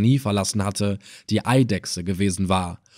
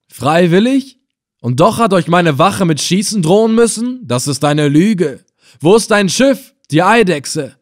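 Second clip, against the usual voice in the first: higher, loud, scornful questioning; angry accusation; 7 semitones higher, 6 dB louder than usual. A narrator's reading. Reis Effendina accusing the helmsman of lying, angry. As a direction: call out the lie.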